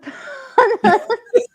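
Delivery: a sudden high squeal of a laugh; high burst of laughter. Real, not acted. Streamer laughing at co-host's jab, high squeal of laughter.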